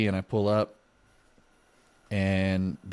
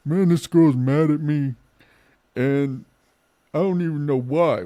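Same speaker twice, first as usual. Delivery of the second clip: put-on "Man, this girl's mad at me" whined much higher and louder in a drunk voice; drunk whining; 7 semitones higher, 7 dB louder than usual. Shown as a demonstration. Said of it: "Like, sorry, hold on".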